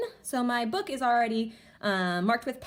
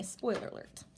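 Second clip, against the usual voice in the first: whispered aside; 9 dB quieter than usual. Real, not acted. Teacher teasing class in aside.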